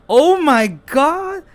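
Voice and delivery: high, drawn-out shocked cry. Real, not acted. Reader reacts to the secret recording, shocked. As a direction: exclaim high and slow in disbelief.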